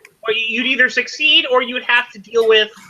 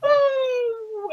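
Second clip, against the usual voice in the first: high, thin falling wail; drawn-out cry fading into the distance; mock falling scream; 4 dB quieter than usual. Game master as villain flying out the window, mock scream.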